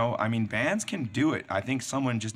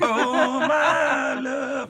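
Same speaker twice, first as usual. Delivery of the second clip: much higher, louder, laughing swoon; mock romantic lover; 13 semitones higher, 6 dB louder, much slower than usual. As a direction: ham up a movie-romance sigh. Mock Ghost pottery romance scene.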